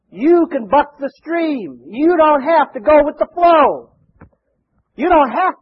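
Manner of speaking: slow, high, forceful preaching; rousing exhortation. Real, not acted. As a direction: urge them on, stretching each word. Preacher drives home the lesson.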